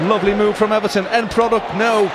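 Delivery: loud, high-pitched peak of an attack; excited commentary. Real, not acted. Commentator follows Everton's passing move, high and loud. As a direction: ride the move at high pitch, full volume.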